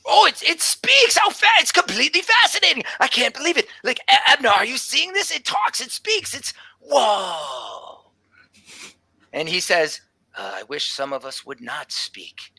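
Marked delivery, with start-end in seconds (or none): 0.0-8.1 very high, breathless delight; excited tabaxi druid. Acted. Druid amazed the construct speaks. 10.3-12.5 lower, softer, fast irritated grumble; grumpy old professor. Acted.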